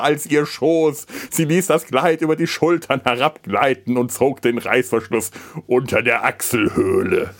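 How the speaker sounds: breathy, seductive read-aloud voice; sultry reading. Acted. Reads erotic novel aloud.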